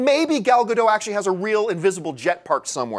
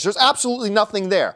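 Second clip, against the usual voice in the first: ordinary talk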